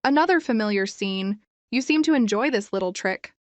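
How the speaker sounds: usual speaking voice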